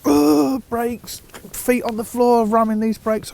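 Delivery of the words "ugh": high strained grunt, louder; imitated braking grunt. Retelling a cyclist's hard braking, high strained grunt. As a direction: mimic the cyclist's jolt as he brakes.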